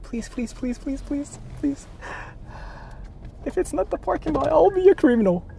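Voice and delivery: high, whiny near-crying plea; begging with a breaking voice; high crying plea, scared about the parking; desperate pleading. Real, not acted.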